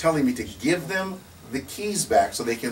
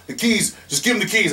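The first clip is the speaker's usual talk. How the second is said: mock officer yell; 9 semitones higher, 5 dB louder than usual. Officer insisting.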